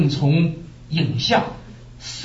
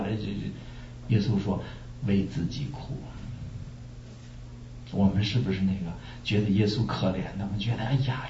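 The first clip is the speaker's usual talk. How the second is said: quiet; 6 semitones lower, 7 dB quieter than usual. Real, not acted. Preacher reflecting on why we weep, softly. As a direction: drop to a soft, reflective tone.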